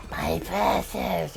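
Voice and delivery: very slow, soft creature croon; Gollum-like creature. Retold or imitated. Clowning as a Lord of the Rings creature, slow creature voice. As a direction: drag it out like a covetous little creature. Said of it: "being one of those creatures in".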